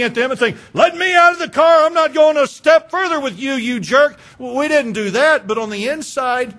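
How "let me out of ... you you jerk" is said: loud, high outburst at a spouse; acted angry yelling. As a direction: yell it like a furious passenger demanding out. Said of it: "start yelling at them and start screaming".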